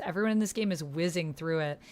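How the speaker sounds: ordinary talk, nothing marked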